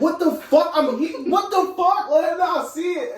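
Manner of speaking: mock shocked outburst. Reacting to a cheating dream, mock shocked. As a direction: burst out as if catching them in the act. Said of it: "The same type of dream where it be like".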